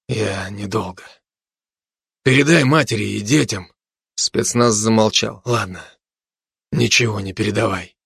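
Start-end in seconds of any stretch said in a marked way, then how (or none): 0.1-3.7 faint, weary last words; fading strength. A narrator's reading. Dying commando gives last words. 5.4-8.0 weak, subdued murmur; fading strength. A narrator's reading. Weak commando gives up.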